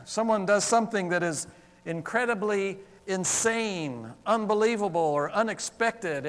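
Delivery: usual speaking voice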